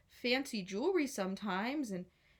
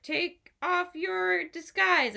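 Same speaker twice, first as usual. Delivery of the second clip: demanding guards; 8 semitones higher, 4 dB louder, much slower than usual. A narrator's reading. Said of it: "they're like".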